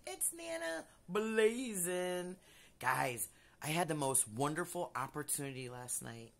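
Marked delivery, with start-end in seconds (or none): 0.1-2.4 drawn-out slow catchphrase; slow sign-on voice. Acted. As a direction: stretch the name out slow, showman style. Signature intro name call.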